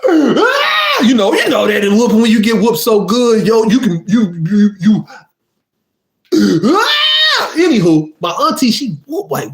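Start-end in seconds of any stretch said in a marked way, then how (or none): 0.0-1.0 pained cry. Retold or imitated. Getting whooped with the belt. 6.6-7.5 high drawn-out "Ah"; pained yell. Retold or imitated. Getting whooped with the belt.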